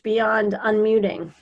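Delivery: ordinary delivery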